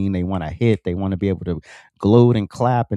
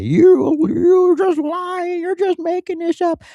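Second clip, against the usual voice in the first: very high, squeaky mock voice; whiny commenter; 6 dB louder, slower than usual. Mocking replay commenters in a squeaky falsetto whine. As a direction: whine like an angry troll in the comments. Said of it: "come into the comment sections".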